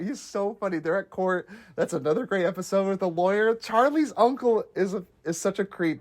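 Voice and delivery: normal talking voice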